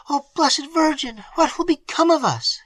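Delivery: high, frantic servant outcry; exclaiming maid. A narrator's reading. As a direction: cry to the Virgin in panic. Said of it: "exclaimed Annette".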